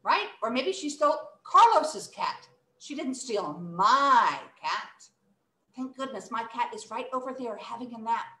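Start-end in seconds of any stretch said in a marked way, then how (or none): 2.8-5.1 "my" drawn out; slow, leaning on "my"; stress on MY. Shown as a demonstration. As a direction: hold the word "my". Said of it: "when we emphasize my".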